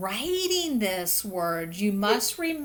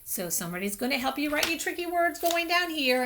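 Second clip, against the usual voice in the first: normal talking voice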